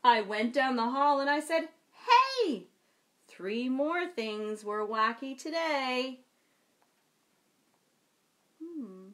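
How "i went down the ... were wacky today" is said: put-on lively, slow read-aloud for children; storytime reading. A narrator's reading. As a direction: read it like a picture book to kids. Reads picture book aloud.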